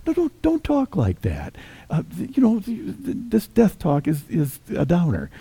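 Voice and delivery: high, anxious pleading friend; pleading Peter. Retold or imitated. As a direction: beg him not to talk of death, voice up high. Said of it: "take Jesus aside and say".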